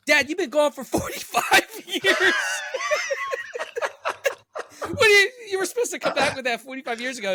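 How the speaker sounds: high, loud scolding that cracks up laughing; laughing, hammed-up indignant yell; mock outrage through laughter. Grandma scolding long-absent dad, cracking up.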